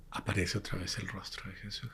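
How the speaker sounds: hushed whispered reveal; whispering. A narrator's reading. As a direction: whisper it like a secret. Climax of the apparition story.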